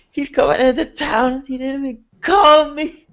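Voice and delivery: put-on high, tearful drunk wail; crying drunk. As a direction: sob out the news, hurt. Drunk father hears son coming.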